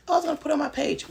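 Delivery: high, fast, loud mock assistant; quick high voice of the careless assistant. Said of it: "assistant voiced in story".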